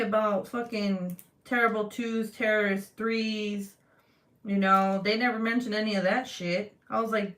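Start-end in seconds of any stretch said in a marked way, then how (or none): none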